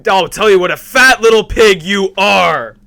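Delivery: very loud, high hammed-up insult; mock tirade. Host topping co-host's movie impression, mock tirade. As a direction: belt it out like a film tirade.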